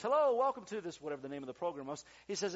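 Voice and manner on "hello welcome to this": high, loud show-opening greeting; projected on-air hello, acting out the pastor; imitated TV host. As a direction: put on a big host's welcome. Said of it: "so the pastor says".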